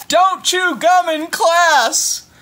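high, loud, slow scolding; teacher's shout. Acted. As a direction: drag out tardy like a cross teacher. Teacher scolding in read book.

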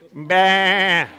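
bleating meh; sheep meh. Shown as a demonstration. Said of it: "One, two, three".

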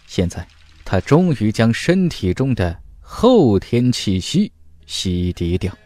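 plain reading voice

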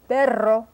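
slow "perro" with a rolled R; drawn-out trill in "perro"; trilled Spanish R. Shown as a demonstration. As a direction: roll out the R in "perro". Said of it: "That's that trilled R".

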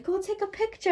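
lightly voiced parent, playful; mock parent. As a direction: chirp it like a pushy parent at Santa's grotto. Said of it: "your parents".

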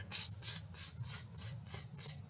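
soft voiceless tss tss tss; tut-tut like a cheeky monkey; monkey tsk. Acted. Monkeys tutting back at the peddler, soft voiceless tss. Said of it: "And they said".

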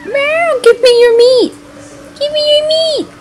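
high, whiny cat voice begging for meat; voicing the cat. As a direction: play the cat demanding food, high and pleading. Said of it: "This cat is so bad".

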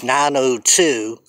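ordinary delivery

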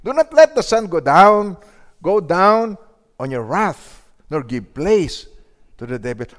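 loud, emphatic verse reading; proclaiming scripture. Real, not acted. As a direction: declare the verse to the church.

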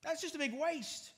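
mock disciples sneering at the gift; scoffing disciples. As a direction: sneer it like someone who sees no value. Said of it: "these disciples said".

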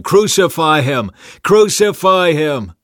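loud, high mob chant, building; repeated shouting. A narrator's reading. Crowd arguing with Pilate, insistent shouting. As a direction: keep shouting, stretching the last cry. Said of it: "they kept shouting".

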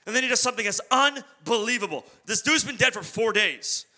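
ordinary talk, nothing marked

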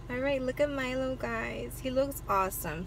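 ordinary delivery

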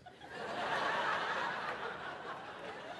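long airy laugh under the breath; breathy laugh. Real, not acted. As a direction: chuckle silently, shaking with it. Tall Korean guy with Scottish accent.

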